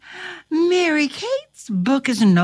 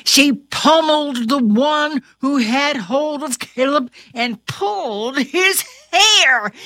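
ordinary delivery, nothing marked

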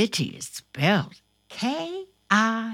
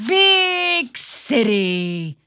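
slow, louder storybook reading; reading to children; 2 semitones higher, 6 dB louder, much slower than usual. Acted. Host reading a picture book to children, slow and clear. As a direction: savour each word for little ones.

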